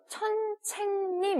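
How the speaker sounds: 천책님 for 선생님, high and slow; imitated Chinese learner. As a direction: mimic a learner saying 천책님 with ch for s. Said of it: "이렇게 발음해요".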